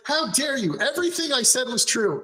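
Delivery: high-pitched, acted indignant protest; mock indignation. Accused character indignantly defends himself.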